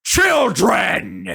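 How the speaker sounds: loud, high booming call of a god; booming shout. Acted. God Odin booms at the four players, loud shout. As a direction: boom it so it echoes round the room. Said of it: "booming voice".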